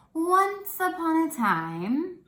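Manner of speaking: high, loud, drawn-out storybook opening; movie narrator. Acted. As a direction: grandly stretch it out like a fairy-tale narrator. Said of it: "the narrator, that says".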